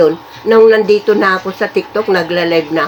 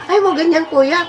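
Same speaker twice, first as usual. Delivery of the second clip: high-pitched imitation of a nagging neighbour; imitated high-voiced objection to crying; mock disapproving neighbour; 7 semitones higher than usual. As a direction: mimic a neighbour telling someone off, voice pitched high. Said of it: "sasabihin pa nung kapitbahay".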